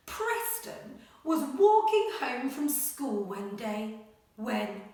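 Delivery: animated picture-book read-aloud; reading to children. Acted. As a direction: read it like a bedtime adventure. Teacher reads story aloud.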